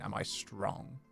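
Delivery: ordinary delivery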